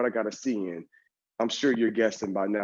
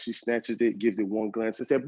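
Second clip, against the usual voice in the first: ordinary delivery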